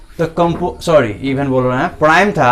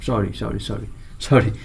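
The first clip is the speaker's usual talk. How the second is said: quiet self-correction; 6 semitones lower, 7 dB quieter than usual. Real, not acted. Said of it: "composite को हम लोग बोलते हैं भाज्य".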